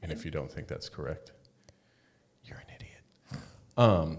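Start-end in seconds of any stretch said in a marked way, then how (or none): 2.4-3.4 whispered, very soft jab; mock-insult whisper. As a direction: drop to a hushed, teasing aside. Joking insult dropped to a whisper.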